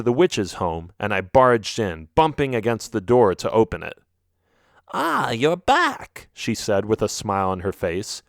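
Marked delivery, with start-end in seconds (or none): 4.9-6.3 put-on slow, high old witch greeting. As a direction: draw it out like a knowing witch. Voices the witch.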